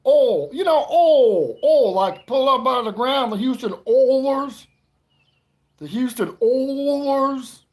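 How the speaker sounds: very loud, high Southern holler. Acted. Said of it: "that two-syllable word you just said".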